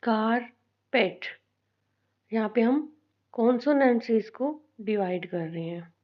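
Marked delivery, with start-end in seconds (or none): 0.0-1.2 carpet as कार, long pause, पेट; split into syllables. Shown as a demonstration. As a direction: say carpet slowly in two separate beats. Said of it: "कार और पेट".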